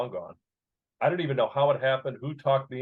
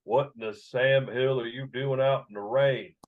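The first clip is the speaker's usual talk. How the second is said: Virginia drawl; slower than usual. Retold or imitated. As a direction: drawl it like an old Virginia ballplayer greeting a kid. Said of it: "that Virginia drawl".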